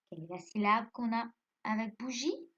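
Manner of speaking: ordinary talk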